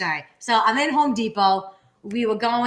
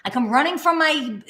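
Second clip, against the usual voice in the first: normal talking voice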